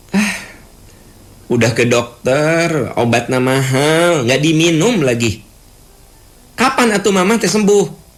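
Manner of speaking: put-on high, whiny sigh of a fed-up child; grumbling complaint. Adult child complaining that mama won't get well, whiny. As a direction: complain about the sick mother with a tired "ah".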